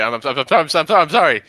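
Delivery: high, loud stammer of a startled apology; flustered stammering apology. Acted. Character caught by the barmaid stammers a loud startled apology. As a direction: blurt sorry, caught in the act. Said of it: "I'll just turn around and be like".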